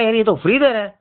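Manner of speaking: ordinary delivery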